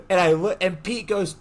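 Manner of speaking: usual speaking voice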